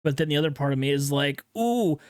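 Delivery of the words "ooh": dumb caveman voice. Pretend. Mocking dumb tattoo urge.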